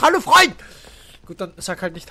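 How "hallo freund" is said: louder, higher coaxing hello; insistent hello. Pretend. Coaxing the character to greet him back, insistently.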